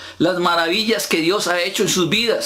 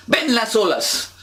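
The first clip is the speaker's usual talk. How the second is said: usual speaking voice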